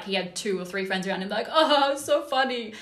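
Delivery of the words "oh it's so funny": high, loud sarcastic sneer; mocking. Club guy mocks her warning, high sarcastic sneer. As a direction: mimic a guy mocking a woman's scolding. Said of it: "he was mocking me".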